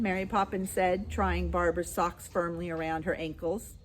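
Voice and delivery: plain reading voice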